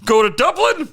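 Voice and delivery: high, put-on Irish brogue; Irish impression. Guru telling people where to go, hammed-up Irish. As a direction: push the Irish lilt right up. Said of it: "Conor McGregor Peterson".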